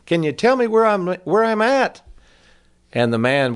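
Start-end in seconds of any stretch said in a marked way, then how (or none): 0.0-2.0 high, raised calling-out question; acted shout from a distance; mock holler. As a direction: call out for directions, pitched way up. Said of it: "hollers at him".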